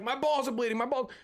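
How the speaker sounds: mock panicked child yelping; panicked kid quote. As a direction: squeal it like a scared nine-year-old. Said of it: "And I was like".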